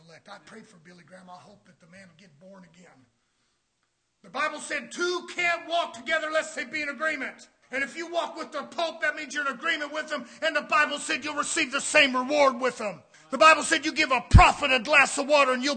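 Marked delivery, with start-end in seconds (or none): 4.2-15.8 loud, high fire-and-brimstone preaching; preaching shout. Acted. Preacher shouting to the church about Canaan. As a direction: thunder it at the congregation.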